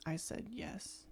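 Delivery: sheepish, barely voiced whisper. Imitating the singer's line, a sheepish whisper. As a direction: whisper it like you're not proud of it. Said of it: "sheepishly, he's like".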